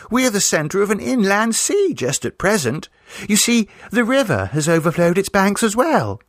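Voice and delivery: high, breathless storytelling niece; imaginative girl. Acted. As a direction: spin the tale with relish. Vera spins flood tale.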